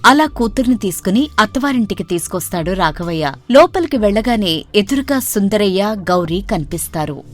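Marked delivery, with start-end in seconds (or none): none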